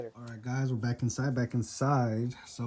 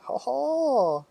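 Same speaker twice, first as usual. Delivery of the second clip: excited; 13 semitones higher, 10 dB louder than usual. Real, not acted. Celebrating successful pour.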